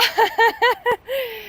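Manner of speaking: high-pitched run of giggles; laughing. Real, not acted. Dance teacher after the music stops, laughing. Laughs as she resumes talking.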